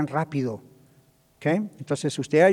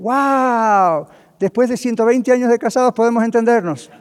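higher and louder, delighted wonder; amazed couple; 6 semitones higher, 5 dB louder than usual. Retold or imitated. Pastor voicing a counseled couple's joyful surprise, excited. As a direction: burst out like a couple who finally understand each other.

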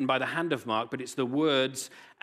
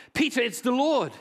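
high excited shout; calling out; 11 semitones higher, 6 dB louder than usual. Acted. John shouting to Peter from the boat, high excited shout. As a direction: shout it across the water.

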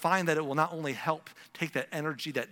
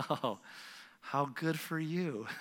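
slow, half-hearted mock gladness; forced congratulations; 5 dB quieter, much slower than usual. Jealous person congratulates the lucky one, slow and insincere. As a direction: congratulate as if it hurts, drawing it out. Acts forced happiness for others.

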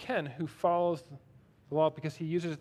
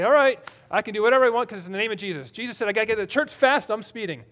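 louder, higher and fast, giddy self-justifying; mock excuse-maker; 5 semitones higher, 8 dB louder, faster than usual. Preacher mocks a churchgoer excusing his speeding, giddy and fast. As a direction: rattle it off like a smug rule-breaker. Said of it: "please don't use this as a miscontext and say".

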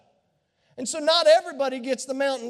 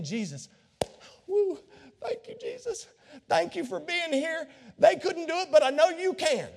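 mock grateful praise; 4 semitones higher than usual. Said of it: "instead of saying".

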